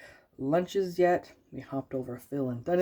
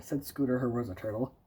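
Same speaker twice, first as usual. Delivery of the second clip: plain reading voice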